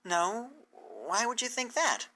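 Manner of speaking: put-on a gently confused young mare; Twilight's voice.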